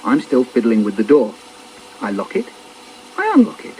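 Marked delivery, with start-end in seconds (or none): none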